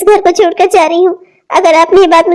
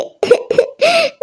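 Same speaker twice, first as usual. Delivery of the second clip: a sharp high sob, softer; 9 semitones higher, 6 dB quieter than usual. Acted. Abandoned wife at the bus stand breaks into a sob. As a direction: catch a breath in a sob. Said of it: "मैं रो रही हूँ".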